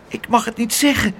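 high, timid voice; frightened boy barely answering; trembling. Acted. Pluk answers the shouting aunt, a little timid. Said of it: "zei Pluk bevend".

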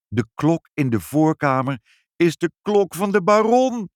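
rising to high and loud; alarmed realisation. Acted. Father realising whose clock it is, alarmed. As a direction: build emphatically to the awful truth.

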